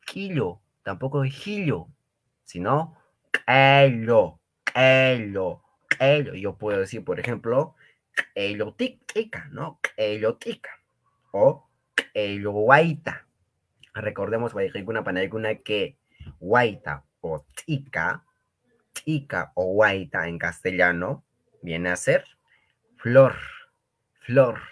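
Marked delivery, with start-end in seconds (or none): 3.5-6.3 ello with a popping, explosive k; ejective k sound. Shown as a demonstration. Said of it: "como si ... explotar algo". 23.0-24.6 flor called out loud; loud teacher emphasis. Shown as a demonstration. Teacher stressing translation.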